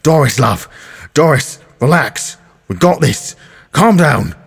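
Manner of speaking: a hint of a gruff mate calming her down; calming tough guy. Brian holding Rochelle, calming Doris down firmly. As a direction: soothe a raging friend, half pleading.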